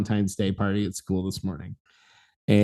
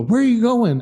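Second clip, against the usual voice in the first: pitched-up call from a stranger; high questioning call; 10 semitones higher than usual. Retold or imitated. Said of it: "this dude looks behind me and go".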